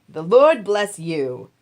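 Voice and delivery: slow, loud call back; loud answer. Acted. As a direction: call back to the master across the field. Harvesters answer Boaz.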